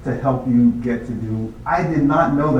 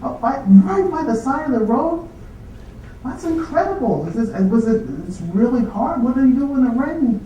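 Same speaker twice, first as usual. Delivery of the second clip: much higher, fascinated mock-dean voice; imitating the dean; 10 semitones higher than usual. Dean quizzing a student who walks everywhere, high amazed voice. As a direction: play the dean as a wide-eyed, high-pitched questioner. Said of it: "And says, uh".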